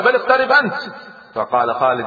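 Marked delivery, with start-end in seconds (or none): 0.0-0.7 high, raised-pitch comeback; sharply lifted challenge; defiant retort. A narrator's reading. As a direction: throw the demand straight back. Wary enemy throws the demand back.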